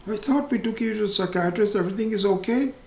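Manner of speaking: hammed-up indignant disbelief; shocked wife. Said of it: "So she says".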